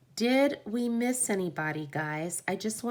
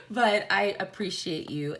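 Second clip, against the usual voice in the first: usual speaking voice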